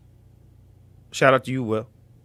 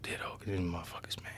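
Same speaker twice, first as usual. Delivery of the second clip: whisper; 10 semitones lower, 23 dB quieter than usual. Real, not acted. Hiding info from trolls.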